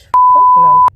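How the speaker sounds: high, drawn-out scream of refusal; furious shriek. Real, not acted. Refusing the boss who begs her to stay, shrieking.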